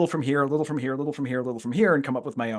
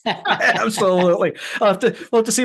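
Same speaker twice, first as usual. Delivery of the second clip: laughing; 7 semitones higher, 6 dB louder than usual. Real, not acted. Laughing agreement at the goodbye.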